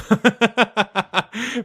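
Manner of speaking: loud, high-pitched laughter. Real, not acted. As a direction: burst out in a real, high laugh. Laughs at own cow remark.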